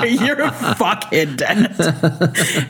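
slow, laughing through the words; laughing insult. Retold or imitated. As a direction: call out the prankster while cracking up. Laughing at dad's prank.

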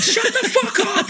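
high, slow, laughing shut up; half-laughing scared snap. Retold or imitated. Telling the toy to shut up, high and laughing. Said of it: "I'm like".

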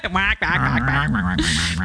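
mocking duck quacks through laughter; duck quacking. Imitates duck after "like a duck.

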